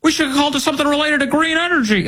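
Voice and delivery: mocking high-pitched, hurried outburst; high, rushed mock-politician voice; Biden. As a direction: imitate a politician blurting it out, high and fast. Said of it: "he goes".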